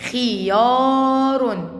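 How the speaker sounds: خيار very drawn out, long held aa; slow word reading. Shown as a demonstration. Teacher revealing the assembled word, slow sounding-out. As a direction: stretch خيار slowly for a learner. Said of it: "تبقى كلمة".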